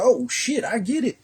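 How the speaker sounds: high, strained mock-cop voice; the cop who finally gets it, in a high rattled voice. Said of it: "And they go".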